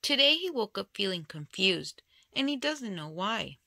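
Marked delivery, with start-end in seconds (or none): none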